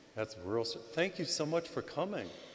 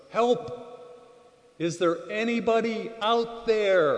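high, loud, drawn-out call for help; stretched, high shout into empty space; cry for help; 9 semitones higher, 7 dB louder, slower than usual. Retold or imitated. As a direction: call out desperately from the cliff edge. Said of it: "so he cries out".

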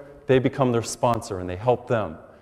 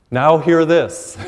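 slow and louder, laughing through it; laughing announcement; 3 semitones higher, 7 dB louder, much slower than usual. Real, not acted. Preacher calling the crowd's attention, slow and laughing. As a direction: draw it out while chuckling.

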